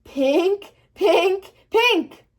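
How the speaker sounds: rising sing-song alarm chime; alarm ringing. Acted. Girl voicing her alarm going off, a sing-song chime. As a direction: chime it like a pink alarm clock.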